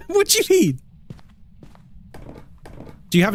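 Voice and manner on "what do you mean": high, incredulous question after a laugh; laugh, then squeal it in disbelief; giggly, high-pitched bafflement. Real, not acted. Laughing disbelief at viewer's remark.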